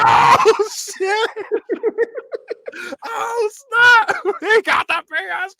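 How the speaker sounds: very high, hammed-up shriek of joy; dramatic kid. Kid seeing the PS two at the door, mock ecstatic shouting. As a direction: squeal it loud like an overexcited kid. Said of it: "I was a dramatic kid".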